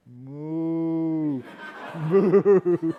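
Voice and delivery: loud, laughing mock moo; cow moo. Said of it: "And he went".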